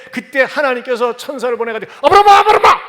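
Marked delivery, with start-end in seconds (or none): 1.9-2.8 아주 높고 크게 급하게 부르는 천사; 멀리서 다급히 이름을 외쳐; urgent calling out. Acted. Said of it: "막 급하게 부르는 거예요". (천사가 아브라함을 급히 부르는 외침)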